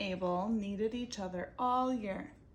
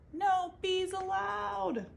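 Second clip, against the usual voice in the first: sign reading; 10 semitones higher, 4 dB louder, slower than usual. Acted.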